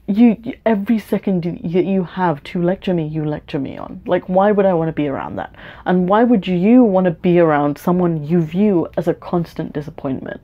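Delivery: ordinary talk, nothing marked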